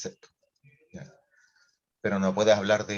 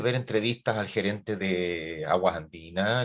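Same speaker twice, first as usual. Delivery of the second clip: usual speaking voice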